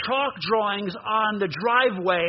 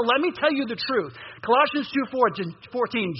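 ordinary talk, nothing marked

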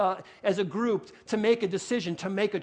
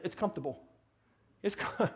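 New York accent; 4 semitones lower, 7 dB quieter than usual. Real, not acted. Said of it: "My New York accent".

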